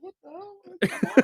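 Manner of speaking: shocked high-pitched yelp; surprised. Retold or imitated. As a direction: blurt it out, startled and confused. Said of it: "I was like".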